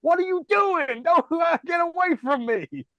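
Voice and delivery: high, loud panicked protest; panicked yell. Retold or imitated. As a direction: fight them off, yelling in alarm. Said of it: "she's like".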